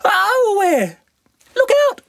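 extremely high loud squeal; panicked sandfly scream. Acted. Sandy warning Namu of the spray, extremely high loud squeal. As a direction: shriek a warning like a tiny bug. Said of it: "cried Sandy".

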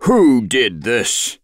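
understated storyteller's yell of anger; angry yell. A narrator's reading. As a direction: call it out angrily, drawing out each word. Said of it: "He yelled out in anger".

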